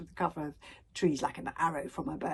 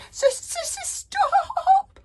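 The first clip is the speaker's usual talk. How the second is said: much higher, stuttering dragon squeal; stammering shriek; 8 dB louder, much slower than usual. A narrator's reading. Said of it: "shrieked Toothless".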